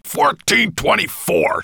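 loud, slow, high-pitched snarl. A narrator's reading. Dragon correcting his storyteller, snarling slowly. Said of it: "snarled Nettlebrand".

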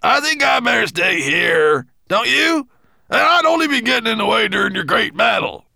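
high, loud pleading shout; dwarf calling out. A narrator's reading. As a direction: shout an excuse up to the master. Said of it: "he called".

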